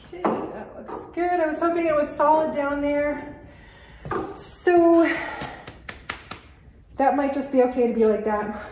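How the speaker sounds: ordinary talk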